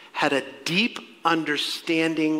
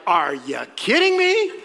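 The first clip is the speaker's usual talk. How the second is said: put-on laughing, eye-rolling teen disbelief; 3 semitones higher, 5 dB louder than usual. Teen answering mom's morning song, laughing disbelief. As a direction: play the exasperated teenager, laughing. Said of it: "you're like".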